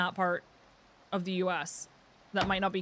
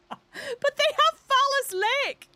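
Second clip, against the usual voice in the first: very high, slow, laugh-choked squeal; cracking up; 5 dB louder, much slower than usual. Real, not acted. Streamer delighted by a rude lake name, cracking up. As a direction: force it out while losing it laughing.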